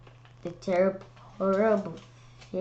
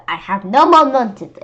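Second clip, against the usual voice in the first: stern frown; 5 semitones higher, 12 dB louder than usual. Acted. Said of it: "I said with a frown".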